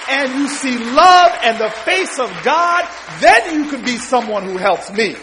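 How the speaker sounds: loud, full-force exhortation; rousing preaching. Retold or imitated. Tenant president challenging the law student, rousing loud exhortation. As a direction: build to a thunderous preacher's climax. Climax of retold woman's exhortation.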